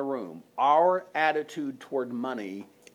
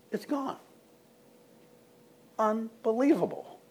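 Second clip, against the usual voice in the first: amazed; 8 semitones higher than usual. Real, not acted. Imagining a vanished debt.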